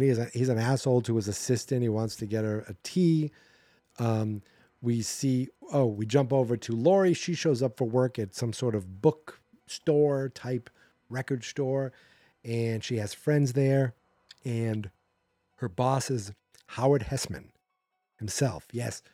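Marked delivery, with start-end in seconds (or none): none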